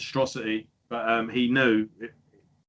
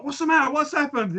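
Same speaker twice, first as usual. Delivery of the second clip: much higher worried voices; family bursting in after hearing a scream; alarmed family; 14 semitones higher than usual. Retold or imitated. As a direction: burst in asking anxiously what happened. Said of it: "everyone just burst in".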